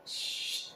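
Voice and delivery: short soft shh; imitated tap. Sitting at the sensor, short imitated hiss. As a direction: imitate a brief unvoiced spurt of water.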